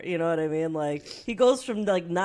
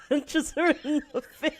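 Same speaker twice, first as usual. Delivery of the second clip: laughing; 10 semitones higher, 5 dB louder than usual. Real, not acted. Laughing retelling of Family Guy.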